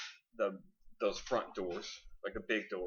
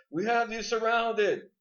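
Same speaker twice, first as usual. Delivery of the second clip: police megaphone; 13 semitones higher, 5 dB louder than usual. Acted. Police outside shouting demands at the bank robbers, megaphone voice. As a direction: boom it out like cops on a loudspeaker. The normal spiel comes up.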